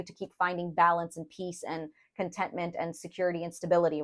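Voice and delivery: normal talking voice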